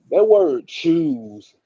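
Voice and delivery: slow, heavy stress on "choose". Shown as a demonstration. Host stressing "choose" to his co-host, slow and heavy. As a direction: lean hard on the word "choose". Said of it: "put the emphasis on that word choose".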